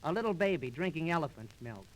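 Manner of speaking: usual speaking voice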